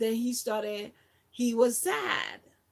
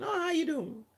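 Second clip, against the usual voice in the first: breezy greeting; 7 semitones higher than usual. Retold or imitated. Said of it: "They just ask the superfluous question".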